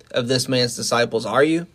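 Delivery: ordinary talk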